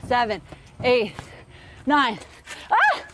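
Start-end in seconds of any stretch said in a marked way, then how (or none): 2.7-3.0 sudden high yelp mid-count; startled yelp. Real, not acted. Surprised yelp as the volley fails. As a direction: coach yelps as the ball gets away.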